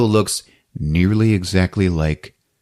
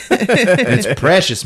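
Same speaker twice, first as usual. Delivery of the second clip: normal talking voice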